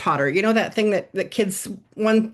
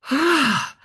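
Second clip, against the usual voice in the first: a higher, released ah of relief; relieved ah; 6 semitones higher than usual. Retold or imitated. Worker flopping into chair, relieved exhale. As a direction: breathe out the tension. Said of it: "collapse back in your desk going".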